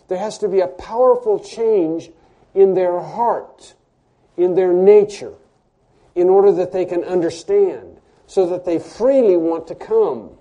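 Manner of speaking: loud, driving sermon delivery; preaching at full force. Acted. Preacher urging the congregation about God's truth, fervent preaching. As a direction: press the truth home to the pews.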